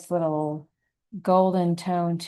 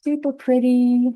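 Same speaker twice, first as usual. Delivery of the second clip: delighted; 7 semitones higher, 7 dB louder than usual. Real, not acted. Admiring her own brushstroke.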